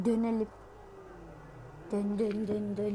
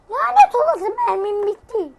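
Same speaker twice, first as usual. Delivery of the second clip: much higher, loud cursing yell; high angry shout at the empty gun; furious outburst; 9 semitones higher, 9 dB louder than usual. Real, not acted. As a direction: explode at running out of ammo. Missed the shot, out of bullets.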